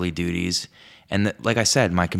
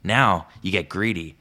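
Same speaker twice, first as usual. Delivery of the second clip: usual speaking voice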